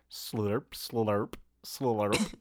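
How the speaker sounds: slurping sound voiced like a cartoon snake. Shown as a demonstration. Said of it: "you could go".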